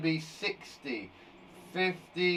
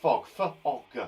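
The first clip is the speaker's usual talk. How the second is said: ordinary talk, nothing marked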